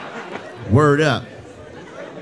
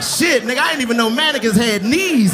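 mock amazed; 6 semitones higher than usual. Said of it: "I'm like".

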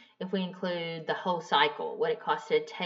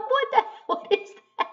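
much higher, laughing disbelief; baffled laughing squeal; 3 dB louder than usual. Retold or imitated. Customer told she bought synthetic oil, high laughing bafflement. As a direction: squeak it out half-laughing, totally lost.

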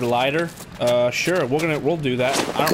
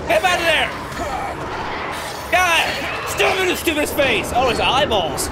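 panicked shouting; 11 semitones higher than usual. Real, not acted. Player flees a creature in a game, panicked shouting. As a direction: yell out in alarm while fighting.

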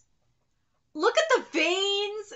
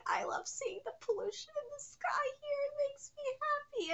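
high, very soft, teary-sounding joy; tiny overcome voice; 7 semitones higher, 15 dB quieter than usual. Real, not acted. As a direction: squeak it out softly, overcome with happiness.

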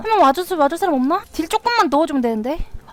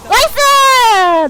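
high, loud, stretched-out cheer; excited cheer; 6 semitones higher, 5 dB louder, much slower than usual. Real, not acted. Streamer cheering a kill, drawn-out excited nice. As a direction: drag the word out in triumph.

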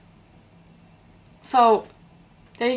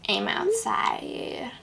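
breathy secretive whisper; whispered text; 6 dB quieter, much slower than usual. Acted. Michelle whispering from outside the house. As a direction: whisper it like a hiding friend.

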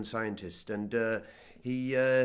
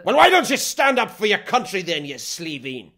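much higher and louder, a sudden furious shout; indignant outburst; 9 semitones higher, 17 dB louder than usual. Acted. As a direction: explode at the interviewer for insulting his country. Interview turns into a quarrel.

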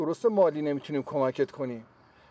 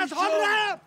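high, frightened cry; alarmed cry; 4 dB louder than usual. Acted. Man seeing the woman faint, alarmed cry. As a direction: cry out in panic.

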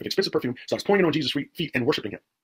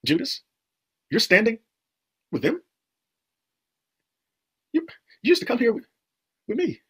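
hurt confrontation; 9 semitones higher than usual. Acted. Preacher voices Jesus confronting Judas.

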